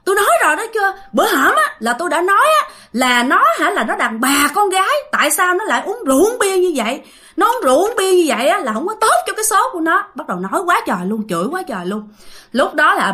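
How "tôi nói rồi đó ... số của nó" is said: high-pitched scolding wail, retold; mock crying out. Possessed sister-in-law crying out "I told you so" to everyone, mock scolding outcry. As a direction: retell an indignant outcry, pitched well up. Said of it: "la làng nói rằng".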